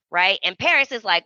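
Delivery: usual speaking voice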